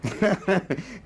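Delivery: a quick amused laugh; short laugh. Real, not acted. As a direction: chuckle at your own blunt answer. Amused by own blunt answer.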